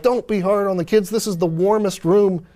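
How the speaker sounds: a hint of high, gently scolding women; lightly voiced women. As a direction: defend the kids with mild reproach. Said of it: "the women are like".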